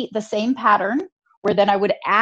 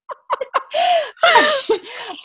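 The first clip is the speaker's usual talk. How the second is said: helpless laugh. Real, not acted. Cracking up at her own story.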